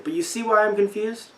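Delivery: normal talking voice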